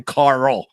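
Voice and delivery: Carl drawn out with an exaggerated American R; super heavy R. Teasing a housemate named Carl, with a super heavy R. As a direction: lean hard on the R in Carl. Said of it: "super duper heavy R".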